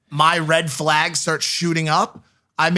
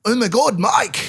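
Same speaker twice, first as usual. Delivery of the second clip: bad Irish accent; 6 semitones higher, slower than usual. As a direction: imitate an excited fan in a poor Irish accent. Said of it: "I'm trying to do Irish".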